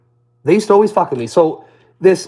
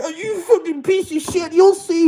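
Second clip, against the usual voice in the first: mock whiny threat; 13 semitones higher, slower than usual. Mocking whimpering man's parting threat.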